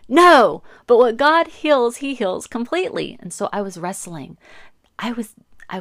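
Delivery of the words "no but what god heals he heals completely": loud, high proclamation of faith; firm declaration. Retold or imitated. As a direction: declare it boldly and loudly. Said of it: "And then I would say".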